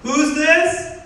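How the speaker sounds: very high, loud rising question; acted calling out. Student calling back to friend far away, acted shout. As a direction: shout the question across a distance.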